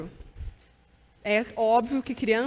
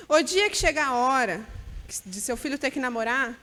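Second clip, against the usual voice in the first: normal talking voice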